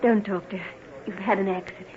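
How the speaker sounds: soothing nurse. Acted. Soothing injured man.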